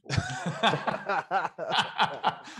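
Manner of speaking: real long burst of laughter; hearty laugh. Real, not acted. Laughter at the joke about the Wallabies.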